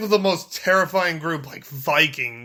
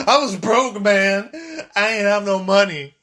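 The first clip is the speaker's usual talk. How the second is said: raised, louder mock protest; broke man protesting; 4 semitones higher, 5 dB louder than usual. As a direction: plead poverty in a put-on retort. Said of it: "homeless man on the streets".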